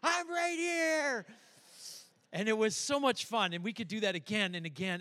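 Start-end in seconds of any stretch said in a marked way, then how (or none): 0.0-1.3 put-on high, slow and drawn out, a little kid's triumphant reveal; grandkid's gleeful high jump-out; child popping out. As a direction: stretch it up high like a kid jumping out. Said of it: "they'd go".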